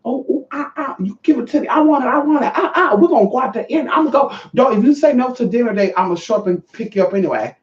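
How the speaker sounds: high-pitched, hammed-up needy demands; aggressive pestering. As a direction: imitate an overeager suitor who won't let up. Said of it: "was so aggressive with me".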